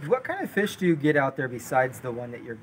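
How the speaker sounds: ordinary talk, nothing marked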